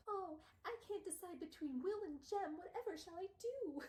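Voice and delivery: high, breathy-free mock damsel voice; high mocking lovesick whine; mock swooning. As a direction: ham up a lovesick heroine. Mocking Tessa's love dilemma.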